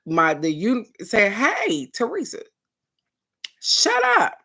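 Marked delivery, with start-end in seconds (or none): 1.3-4.4 rising high, sharp shut up; higher self. Retold or imitated. Her higher self telling Teresa to shut up, high and sharp. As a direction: scold yourself from above. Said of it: "my higher self, you said".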